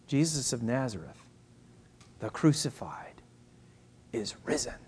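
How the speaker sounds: soft, falling to a whisper; hushed reverence. Real, not acted. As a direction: let it sink into an awed whisper, pausing between phrases. Preacher reverently announcing the resurrection.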